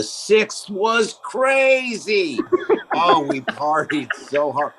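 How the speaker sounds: slow, high laughing boast. Acted. Guest boasting about the sixth, laughing. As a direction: laugh it out slowly.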